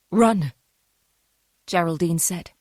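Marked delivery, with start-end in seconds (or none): 0.1-0.5 urgent breathless plea, louder. A narrator's reading. Dying mother warns her daughter, breathless and urgent. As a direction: gasp it out as a warning.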